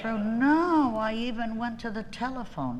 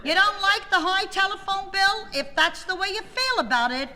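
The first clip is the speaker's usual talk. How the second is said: high, indignant comeback at a husband; retold yelling; 10 semitones higher, 4 dB louder than usual. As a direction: raise the pitch and tell him off. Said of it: "he yelled and I yelled".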